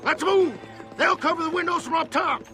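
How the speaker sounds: rally the men forward with a yell; shouted command. Acted. Leader moves his men in under cover, shouting.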